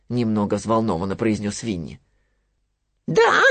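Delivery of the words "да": high piglet voice, pushing the point, fast; insistent Piglet. Acted. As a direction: keep insisting in a squeaky rush. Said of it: "не унимался Хрюня". (настойчивый писклявый Хрюня)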